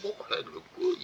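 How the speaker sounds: breathy, very high gasp of admiration; awed whisper. Retold or imitated. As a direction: whisper it in wide-eyed awe. Man gawking at woman.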